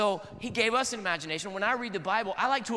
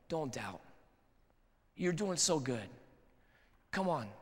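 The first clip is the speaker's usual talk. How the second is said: soft, lower, tender encouragement; gentle reassurance; 4 semitones lower, 5 dB quieter than usual. Pretend. Jesus lifting up a doubter, soft tender reassurance. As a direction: reassure them quietly, like a kind father. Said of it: "he won't be funny about it".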